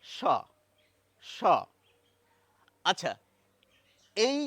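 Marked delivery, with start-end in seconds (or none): 0.0-1.4 repeated স sound demo; teacher demo of স. Shown as a demonstration. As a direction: demonstrate the স sound twice, slowly. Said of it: "সেটাই হচ্ছে".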